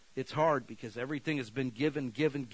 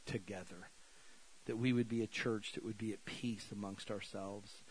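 prayer; 2 semitones lower, 5 dB quieter than usual. Real, not acted. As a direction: pray quietly over the church. Closing prayer.

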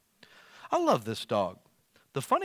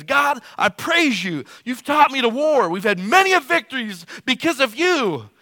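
high, loud, exultant proclamation; triumphant warrior praise; 10 semitones higher, 8 dB louder than usual. Retold or imitated. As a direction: shout praise like a victorious king. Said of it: "He says".

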